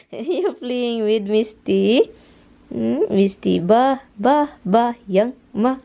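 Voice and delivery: ordinary talk, nothing marked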